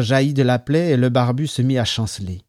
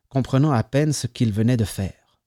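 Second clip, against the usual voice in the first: ordinary delivery, nothing marked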